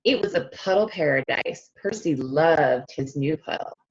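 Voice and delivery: put-on lively storybook reading for young kids; read-aloud narration. A narrator's reading. Teacher reading a picture book to her class, animated read-aloud. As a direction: read it like circle time, bright and inviting.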